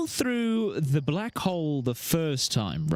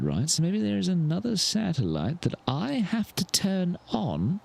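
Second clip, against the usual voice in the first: ordinary talk, nothing marked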